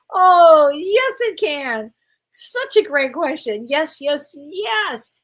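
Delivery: a loud, high "Oh", then slow "yes, it can"; emphatic yes. Real, not acted. Answering a viewer's question about anger at home, an emphatic loud agreement. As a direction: burst out in agreement, then draw it out.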